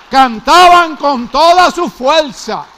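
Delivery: voz alta, lenta y proclamada; con toda fuerza. Shown as a demonstration. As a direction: predicar a pleno pulmón. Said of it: "con fuerza". (proclamar con toda la fuerza)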